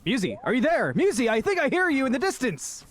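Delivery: calling out as if to someone far away; playful calling out. Pretend. Calling partner lost in the dark.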